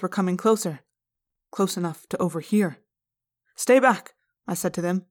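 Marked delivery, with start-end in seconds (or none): 3.5-4.1 raised, louder warning call; firm warning. Acted. Narrator to approaching pupils, warning them off. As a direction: hold them off with your voice.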